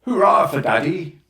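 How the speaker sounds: loud, high cheer; shouting. Acted. Roger cheers the telegram from Daddy, loud shout. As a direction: throw it out as a joyful cheer. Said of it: "he shouted".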